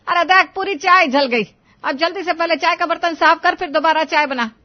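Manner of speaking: scolding mother-in-law. Acted. Mother-in-law blames daughter-in-law for burnt tea, scolding.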